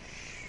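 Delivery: long soft shush; hushing shh. Retold or imitated. Preacher voices Paul quieting the questioners, hushing. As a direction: hush the room with a drawn-out shh. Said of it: "what Paul's telling us is".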